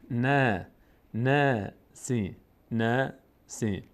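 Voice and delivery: "نه" long, thin and fronted, then a drawn-out "سی"; thin vowel. Shown as a demonstration. Teacher building the word's ending, thin slow syllables. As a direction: keep "نه" light and spread, slowly joining "سی".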